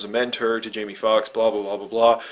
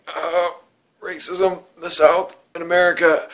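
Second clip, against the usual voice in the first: mock outrage; 6 semitones higher than usual. Mocking a scene in the movie.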